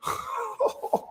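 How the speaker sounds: high, soft wheezy laugh; quiet high-pitched laugh, rising at the end. Real, not acted. Commentator reacting to a crude clip, high soft laugh.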